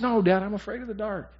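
a hint of a small boy pleading; scared little boy. Pastor voicing his four-year-old son at night, lightly childlike. Son's words voiced in story.

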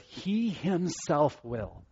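He himself will, each word slow with weight on He; slow emphatic reading. Shown as a demonstration. Preacher savouring Peter's promise, slow and stressed. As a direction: lean hard on He, slowly. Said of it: "that's the way he says it there".

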